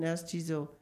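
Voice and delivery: ordinary delivery, nothing marked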